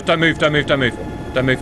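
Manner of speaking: urgent pleading. Real, not acted. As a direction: hold everyone still, it's close. Enemy sub very close.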